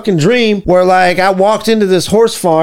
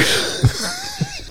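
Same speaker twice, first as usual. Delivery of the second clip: squeaky burst of laughter; a sudden high cackle; high-pitched laugh; 10 dB quieter than usual. Real, not acted. Laughs at own joke.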